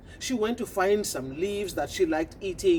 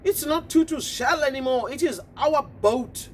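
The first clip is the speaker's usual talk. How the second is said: smug toads; 6 semitones higher than usual. Acted. Toads taunting.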